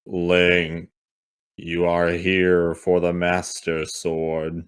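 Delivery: slow, booming tree spirit voice; a hint of a booming deity. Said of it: "she says in a booming voice".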